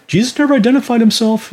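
high, scoffing voice of a doubter; a sarcastic doubter, pitched well up; mocking skeptic. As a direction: mimic the critic's claim with a sneer. Voicing doubters in the building.

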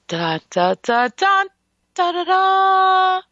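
a drumroll of da-da-da toward the reveal; rising dramatic build. Acted. Dramatic build-up before naming dialysis.

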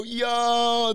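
loud, high hollered "yo"; mocking crowd outburst. Friends all react to his confession, loud mocking outburst. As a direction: whoop it out like a jeering group. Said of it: "They're all laughing their ass off".

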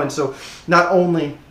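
ordinary talk

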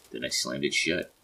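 ordinary delivery

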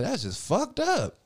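high, slow hurt disbelief; high slow wounded voice. Retold or imitated. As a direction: stretch it out, stung. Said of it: "I'm like".

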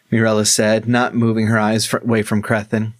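usual speaking voice

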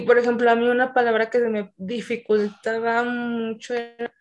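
usual speaking voice

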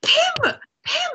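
high, delighted cry of recognition; joyful high-pitched name call; excited greeting. Acted. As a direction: call out to an old friend you just spotted. Acted greeting of an old friend.

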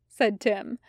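usual speaking voice

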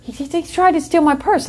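high, sweet re-enacted voice. Telling the police about the purse snatcher, high and sweet. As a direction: play yourself sounding sweetly innocent. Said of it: "I sounded sweet".

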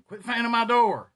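loud, high imitated granny yell; scolding granny. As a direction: holler at running kids like an annoyed granny. Granny scolding kids running in out.

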